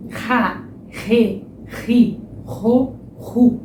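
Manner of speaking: Ra, re, ri, ro, ru each with a breathy h-like R; strong R syllables. Shown as a demonstration. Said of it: "são todos sons com R forte".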